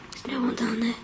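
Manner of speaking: hushed whisper, barely voiced. Real, not acted. Ghost hunter checking the stairs, whispering. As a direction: whisper so nothing downstairs hears. Quiet ghost hunt in the house.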